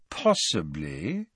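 low, slow, drawn-out hedge; soft and reluctant, rising at the end; noncommittal. A narrator's reading. Borgin unwilling to commit himself to Malfoy, guarded. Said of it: "in a tone that suggested he was unwilling".

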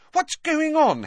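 high, startled demand; shocked question. A narrator's reading. Girl shocked by sudden appearances, demanding answers in a high voice. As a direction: ask it in alarm, pitched high. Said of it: "still looking shocked by these sudden appearances".

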